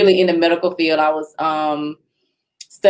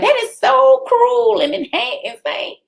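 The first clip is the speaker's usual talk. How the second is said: high, drawn-out horrified imitation; appalled objection. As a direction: stretch it out slowly, pitched way up. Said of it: "she was like".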